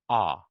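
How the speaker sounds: "ah" said as a long open vowel. Shown as a demonstration. Teacher modelling the "ah" vowel. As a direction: drop the jaw for "ah". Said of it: "the sound is".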